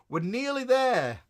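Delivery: high, slow and loud sing-song; nearly there. Real, not acted. Host promising viewers the new subscription, sung out high. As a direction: stretch it out, bright and lifted. Announcing progress to the chat.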